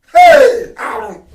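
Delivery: a sudden high yelp of a hey; sharp call out. Real, not acted. Speaker breaking off to call out, sharp high yelp. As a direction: snap their attention with one high cry.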